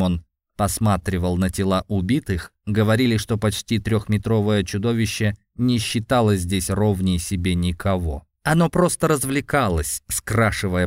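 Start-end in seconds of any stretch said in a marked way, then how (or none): none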